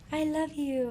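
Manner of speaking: soft, high, tender morning voice; sweet, hushed mimicked endearment; imitating loving boyfriend. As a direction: coo it softly, as the waking boyfriend. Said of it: "He, like, wakes up in the morning".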